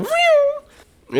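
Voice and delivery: a high, airy "Phew!" sound effect; playful rescue sound; mock superhero whoosh. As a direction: swoop in like a superhero with a silly noise.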